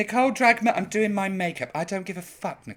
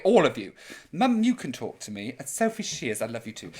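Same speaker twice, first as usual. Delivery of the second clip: ordinary talk, nothing marked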